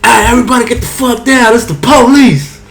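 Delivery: high, loud fake police raid yell; hammed-up police bust-in shout; mock police shout. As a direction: bust in yelling like a cop. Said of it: "And then I said".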